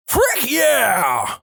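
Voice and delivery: high, drawn-out yell; yelled catchphrase. Acted. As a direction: yell it in place of a greeting. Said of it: "and he yells".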